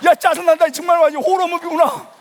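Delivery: high, loud exasperated outburst; retold annoyance. Unbeliever trapped in dark worship, exasperated. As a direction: blurt it out, acting fed up. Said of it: "이런 생각이 들면서".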